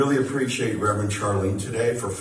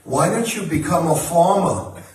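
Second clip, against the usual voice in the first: a son imitating his mom's Long Island accent, raised higher and louder; slow, mock Long Island mom; a hammed-up Long Island mother, nudging her son; 6 semitones higher, 5 dB louder, slower than usual. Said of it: "in her Long Island accent".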